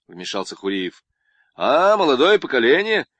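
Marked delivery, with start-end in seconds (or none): 1.5-3.1 louder, higher booming «А, молодое поколение!»; jovial booming welcome; loud hearty greeting. Acted. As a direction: greet them cheerfully and loud. Corrected hearty take.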